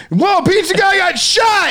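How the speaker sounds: high, drawn-out mock whoop; mock excited yell. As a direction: yell it high and slow, aping an amused onlooker. Mock reaction of friend laughing about it.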